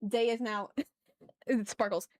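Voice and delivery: usual speaking voice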